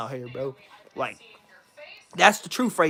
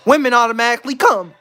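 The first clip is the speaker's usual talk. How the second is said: ordinary talk, nothing marked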